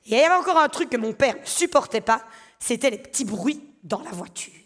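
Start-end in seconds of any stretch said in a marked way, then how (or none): 2.6-4.5 hushed whisper. Real, not acted. Telling the audience about car noises, whispered. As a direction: whisper it like a secret.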